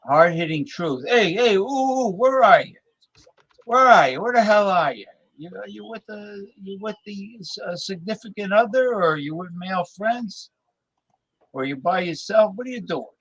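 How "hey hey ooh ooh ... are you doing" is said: high, teasing sing-song hey; mock call-out. Podcast host teasing his unseen listeners in a high sing-song call.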